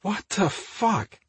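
high, drawn-out exasperated growl; understated, slow growl of annoyance. A narrator's reading. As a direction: growl it slowly in disbelief, without shouting. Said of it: "Mike growled".

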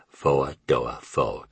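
slow Southern drawl on "four-door Ford"; "four-door Ford" as a languid Southern American drawl, each word drawn out; Southern American accent. Shown as a demonstration. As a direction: stretch "four-door Ford" out slowly, Southern-style, "fo-ah do-ah Fo-ahd". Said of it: "only she pronounced it".

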